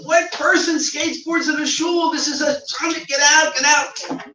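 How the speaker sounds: high, loud scolding to get out; mock furious yell; angry scolding. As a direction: throw the skater out of the shul. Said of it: "You were screaming at me".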